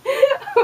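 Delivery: high short laugh; amused laugh. Real, not acted. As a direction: laugh at the failed prank. Finds the prank hiding spot.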